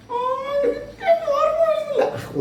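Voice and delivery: very high, squeaky puppet voice; high-pitched kids' show puppet; Jikkumon. Acted. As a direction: play a tiny child character in a squeal. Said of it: "സുഹൃത്തിൻ്റെ പേര് ജിക്കുമോൻ എന്നാണ്".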